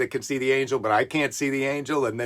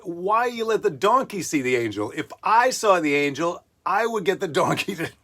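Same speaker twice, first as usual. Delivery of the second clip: lightly voiced whiny gripe, a touch higher; mock complaint to God; 4 semitones higher than usual. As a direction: complain to God half-joking. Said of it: "I would have said, like".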